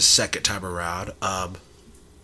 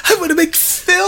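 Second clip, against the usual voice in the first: put-on high whining kid pleading; whimpering child begging to make films; whiny child voice.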